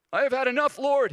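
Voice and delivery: high, loud weary plea; Elijah despairing. Retold or imitated. Preacher voicing Elijah praying to die, high loud plea. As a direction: cry out, worn to the bone. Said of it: "prayed that he might die".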